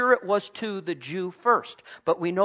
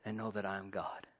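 much softer and lower, calm; soft divine voice; 8 semitones lower, 12 dB quieter than usual. Retold or imitated. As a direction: speak as God, hushed and still. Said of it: "He says".

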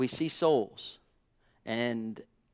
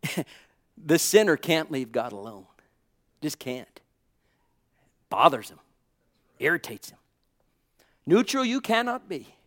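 ordinary talk

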